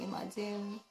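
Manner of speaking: ordinary talk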